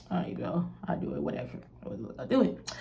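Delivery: soft, resigned "all right, bro" under the breath; resigned mumble. Retold or imitated. Telling the manager she'll take her nails off, muttered quietly. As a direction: give in quietly, like whatever.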